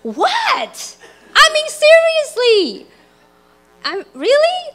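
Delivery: high-pitched defensive disbelief; hammed-up self-justifying squeak; indignant inner voice. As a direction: protest your innocence, incredulous and high. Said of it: "Having this internal dialogue".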